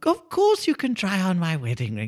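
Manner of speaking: put-on high, sweet old lady voice. Old lady happily answering the burglar, high sweet voice. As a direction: play a doting old lady.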